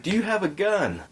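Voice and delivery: high-pitched imitation of a frantic stranger; distraught man. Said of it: "like, distraught".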